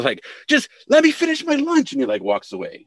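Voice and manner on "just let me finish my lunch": much higher, louder squawk of frustration; exasperated editor. Retold or imitated. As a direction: whine it like a man pushed past his limit. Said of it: "he finally gets so exasperated".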